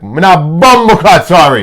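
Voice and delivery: loud, drawn-out Jamaican patois; mock patois. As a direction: put on a big, slow patois accent. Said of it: "culturally appropriating".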